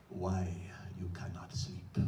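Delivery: put-on a clerk's Egyptian accent, hushed and low; heavy Egyptian accent. Bodega clerk asking the sleepless writer, low Egyptian accent. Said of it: "he says in a heavy accent".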